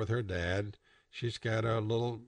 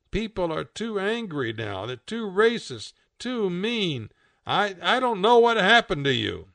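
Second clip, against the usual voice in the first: higher-pitched, plaintive protest; upset complaint; 7 semitones higher, 4 dB louder than usual. A narrator's reading. Pig refuses the world's invitation, upset. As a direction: lament how things have changed.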